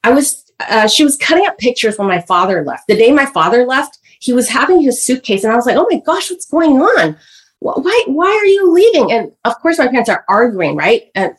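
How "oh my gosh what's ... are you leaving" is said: put-on scared child. Child voiced seeing father leave.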